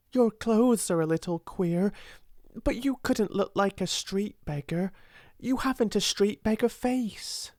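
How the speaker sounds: high-voiced, insistent little girl; earnest protest. A narrator's reading. Ermengarde denying her friend looks beggarly, earnest protest.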